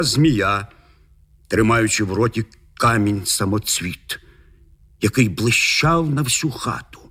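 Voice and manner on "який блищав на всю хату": plain reading voice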